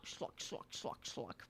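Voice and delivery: breathy whispered "schluck" sound effect, repeated; whispered slurp noises. Pretend. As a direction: hiss out the slurps like a cartoon sound.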